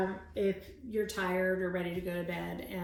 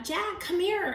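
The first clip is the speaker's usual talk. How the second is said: high, louder call; 9 semitones higher, 5 dB louder than usual. A narrator's reading. Annie calling Jack from the hilltop, raised voice. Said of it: "Annie called".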